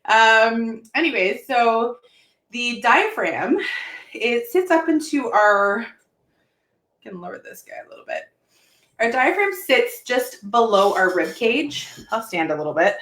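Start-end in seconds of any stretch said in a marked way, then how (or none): 5.8-8.2 muttered aside, near whisper; quiet aside. Real, not acted. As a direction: drop to a soft aside. Aside while adjusting camera.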